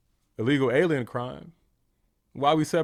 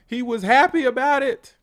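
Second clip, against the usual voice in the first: indignant outburst; 11 semitones higher, 7 dB louder than usual. Real, not acted. Outraged at Kimmel mocking a death.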